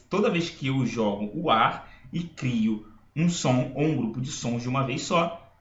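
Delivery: usual speaking voice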